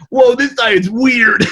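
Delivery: drawn-out, high amazed whoa; slow, stunned disbelief at the scale. Retold or imitated. Retelling weight loss to friend, amazed.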